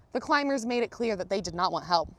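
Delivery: usual speaking voice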